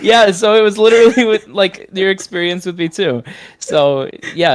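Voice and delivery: high, loud laughing reply. Real, not acted. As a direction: talk through a burst of laughter. Laughing at shared voice surprise.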